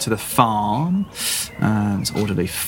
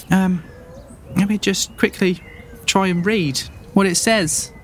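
put-on high, excited little boy boasting; a kid bragging to adventurers; eager farm boy; 7 semitones higher than usual. GM keeps voicing boy.

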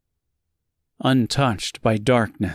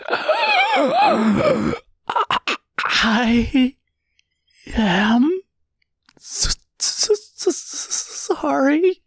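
high, stammering sobbed apology; crying out; much slower than usual. A narrator's reading. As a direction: choke out the apology through tears. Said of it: "she cried out".

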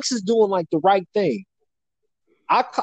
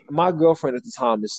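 usual speaking voice

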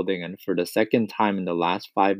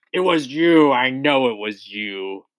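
accuse, stretching the words out; roared accusation; 3 semitones higher than usual. A narrator's reading. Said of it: "she roared".

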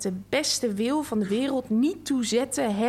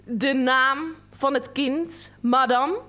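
slower, louder, sharper question; an official losing patience, slow and pointed; even more snappish; 4 dB louder, slower than usual. A narrator's reading. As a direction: press her harder, word by word. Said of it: "nog vinniger".

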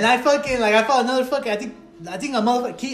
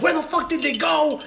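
pissed off; 6 semitones higher, 3 dB quieter than usual. Retold or imitated. Storyteller demanding where his attackers went, mock pissed off. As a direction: act out getting up angry, without shouting. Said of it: "getting up all pissed off".

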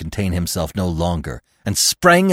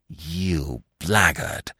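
low, slow, soft insult; hissed contempt; 5 semitones lower, 5 dB quieter, much slower than usual. A narrator's reading. Fox naming the dog's crime, low hateful hiss. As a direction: drag the insult out with quiet hatred. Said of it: "he hissed".

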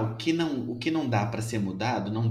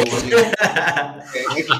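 laughing; 5 semitones higher, 8 dB louder than usual. Real, not acted. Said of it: "Eu tive crise de riso".

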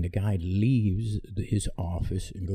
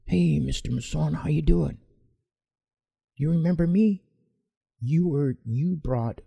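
cheerful greeting, much higher pitch; friendly sergeant; 9 semitones higher than usual. Retold or imitated. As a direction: hail an old acquaintance, bright and lifted.

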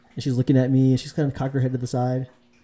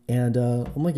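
usual speaking voice

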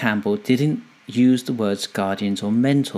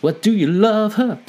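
excited sidekick voice; 9 semitones higher, 3 dB louder than usual. Retold or imitated. Donkey challenges Shrek in retold scene.

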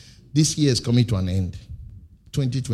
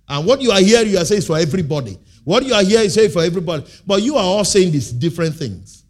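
louder, faster rebuke; heated fast preaching; 4 semitones higher, 5 dB louder, faster than usual. Acted. Preacher rebuking churches, fast and loud. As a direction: press the point hard.

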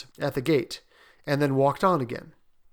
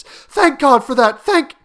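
loud, higher, slow outcry; fervent relief; 11 semitones higher, 12 dB louder, slower than usual. A narrator's reading. Mother hearing her son feels no pain, crying out in relief. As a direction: pour out relief to heaven.

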